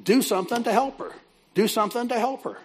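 high-pitched urging voice, imitated; inner voice. Voice prompting the woman to help her crying friend, urgent. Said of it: "I was hearing this voice saying".

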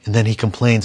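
normal talking voice

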